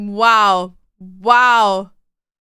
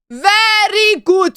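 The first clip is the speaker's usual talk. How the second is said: slow praise; 4 semitones higher, 3 dB louder, much slower than usual. Pretend. Repeats praise slowly.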